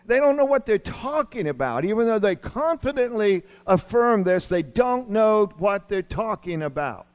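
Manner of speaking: high, loud, emphatic declaration; forceful preaching. Acted. Preacher giving Paul's verdict on false teachers, forceful. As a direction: drive each point home from the pulpit.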